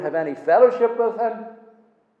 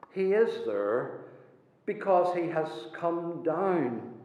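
ordinary talk

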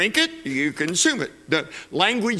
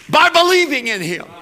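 higher and louder, ringing out the point; belting the key phrase to the congregation; preaching climax; 9 semitones higher, 7 dB louder than usual. Real, not acted. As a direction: lift it up like the peak of a sermon.

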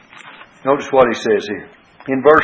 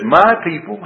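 "My" punched higher than the usual talk; stress on MY; 6 semitones higher than usual. Shown as a demonstration. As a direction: make "My" the word that counts. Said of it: "emphasis is upon the word "my".

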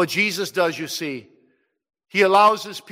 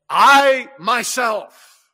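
"I myself" slow, much higher and louder; drawn-out "I myself", loud and high; emphatic I myself; 7 semitones higher, 10 dB louder, much slower than usual. Shown as a demonstration. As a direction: lean hard on "I myself". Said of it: "in what's called the emphatic tense".

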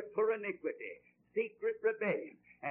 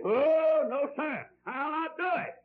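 acted refusal; 6 semitones higher, 5 dB louder than usual. Acts out Jonah refusing God.

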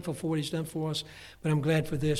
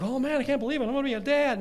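higher and louder, thrilled disbelief; excited new dad; 9 semitones higher, 6 dB louder, faster than usual. Retold or imitated. Preacher imitating himself hearing of the first baby, thrilled. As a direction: burst out with joy at the news. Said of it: "the first time it's like".

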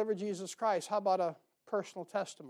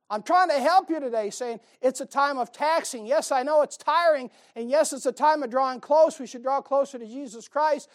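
raised, louder preacher exhortation; full-force preaching; 6 semitones higher, 7 dB louder than usual. Acted. Pastor urging the congregation at Christmas, preaching at full force. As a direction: drive the message home from the pulpit.

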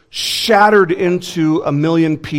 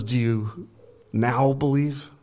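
solemn, measured question with a pause; understated righteous indignation; 4 dB quieter, slower than usual. Acted. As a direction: ask it gravely, without joy. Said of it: "he's, he's very solemn".

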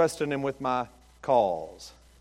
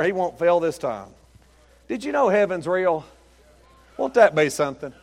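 ordinary talk, nothing marked

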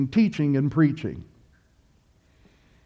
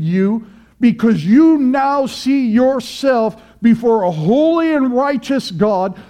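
rising, louder sermon voice; fiery preaching; 4 semitones higher, 4 dB louder, slower than usual. Acted. Preacher exhorting congregation to repent, fiery preaching. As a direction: preach it hard to the pews.

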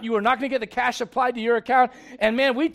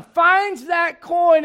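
slow and loud; 5 semitones higher, 4 dB louder, much slower than usual. Acted. Preacher to congregation, slow loud climax. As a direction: drag it out, big and loud.

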